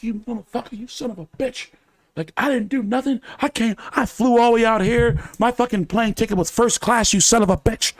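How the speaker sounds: high-pitched cussing, half under breath; hissed insults, voice up high; retold cursing at the guards; cussing them out. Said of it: "I'm cussing".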